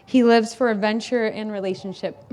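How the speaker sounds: normal talking voice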